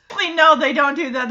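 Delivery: normal talking voice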